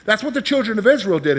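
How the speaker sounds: usual speaking voice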